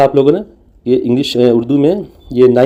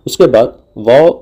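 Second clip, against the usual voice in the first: ordinary delivery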